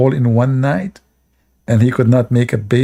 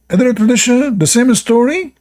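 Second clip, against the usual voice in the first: pitched way up, mocking; high mocking repeat; 11 semitones higher than usual. Shown as a demonstration. Mocking the story, repeated in a high voice. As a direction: echo it high and incredulous.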